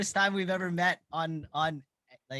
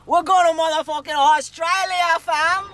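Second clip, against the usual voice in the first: hyped shout; 7 semitones higher, 8 dB louder than usual. Pretend. Excited shouted reply.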